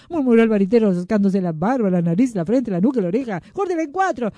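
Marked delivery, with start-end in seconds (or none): 3.5-4.3 high-pitched wand-maker's command; insistent order. A narrator's reading. Wand-maker ordering yet another cut, insistent.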